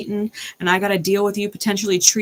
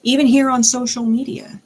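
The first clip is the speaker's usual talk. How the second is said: ordinary delivery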